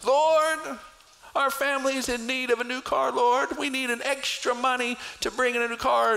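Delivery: mock begging prayer. Believer asking God for car money, high pleading whine. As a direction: beg God like a kid asking for a toy.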